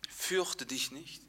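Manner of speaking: soft, slow and comforting; calm the kneeling man gently; tender king. Retold or imitated. King soothing the kneeling cripple, soft and slow. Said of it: "der König sagt".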